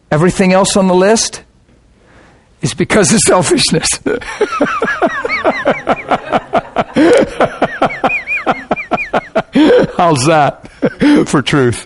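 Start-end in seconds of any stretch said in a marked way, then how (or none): 3.9-9.9 long high laugh, falling away; hearty laugh; big laugh. Real, not acted. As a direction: burst out laughing at the blunt truth. Preacher laughs at own point.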